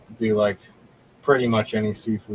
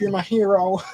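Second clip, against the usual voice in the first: grateful grandma; 10 semitones higher than usual. Retold or imitated. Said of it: "She's like".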